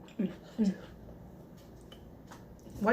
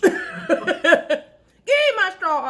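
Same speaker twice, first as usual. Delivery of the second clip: laughing demand; 8 semitones higher, 7 dB louder, much slower than usual. Pretend.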